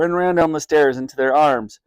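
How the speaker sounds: usual speaking voice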